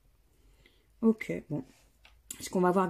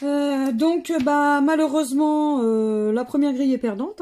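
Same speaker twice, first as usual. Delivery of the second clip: louder disappointed announcement; 4 semitones higher, 6 dB louder than usual. Real, not acted. Announcing a losing grid.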